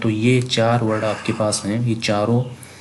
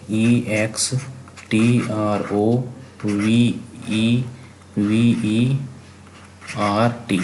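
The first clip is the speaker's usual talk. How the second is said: slow spelling; much slower than usual. Shown as a demonstration. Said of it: "spelling पे इसीलिए मैं बहुत जोर दे रहा हूँ".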